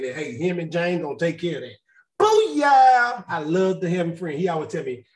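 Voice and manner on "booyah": loud high celebratory whoop; exultant shout. Real, not acted. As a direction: shout it out in triumph. Celebrating the offer of help.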